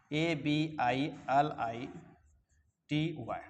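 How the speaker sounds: spelling it out slowly, letter by letter. Shown as a demonstration. Teacher spelling a word for students, slowly letter by letter. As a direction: pause on each letter so students can write.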